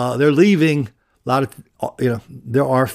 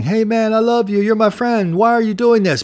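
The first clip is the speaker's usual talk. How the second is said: higher-pitched imitation of a desperate plea; retold begging; 9 semitones higher than usual. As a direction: mimic a man pleading for his life, half-acted. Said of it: "You hear the guy begging".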